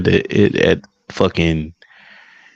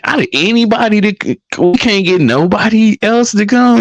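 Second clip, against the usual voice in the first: exasperated rant; 12 semitones higher, 3 dB louder than usual. Retold or imitated. Complaining in disbelief.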